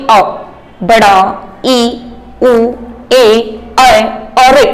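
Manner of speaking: each vowel "अ", "ई", "उ", "ए", "ऐ" said slowly and separately, lips shaped clearly; slow vowel demo. Shown as a demonstration. Teacher sounding out vowels for students, slow and deliberate. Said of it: "बोल के भी देखेंगे".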